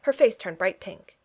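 plain reading voice

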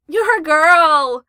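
loud, high, drawn-out wail. Acted. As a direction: wail it out in dismay, very slowly. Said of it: "Nakatsu wailed".

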